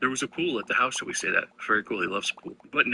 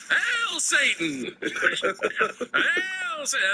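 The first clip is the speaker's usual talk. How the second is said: slow, high, booming Hail Satan yelled with a laugh; drawn-out shouted hail; much slower than usual. Shown as a demonstration. Hosts' vocal warm-up, a slow shouted hail. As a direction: bellow it slowly like a vocal warm-up. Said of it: "just go".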